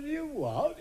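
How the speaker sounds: high startled "You what?"; mock shock. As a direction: jump up high in disbelief. Joke dialogue, shocked reply.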